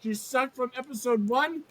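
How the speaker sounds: ordinary delivery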